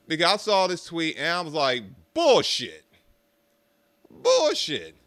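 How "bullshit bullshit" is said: loud scoff, high then falling; scornful disbelief. Retold or imitated. Reacting to a tweet he thinks is fake, scornful. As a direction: call it out as nonsense, punched and dismissive.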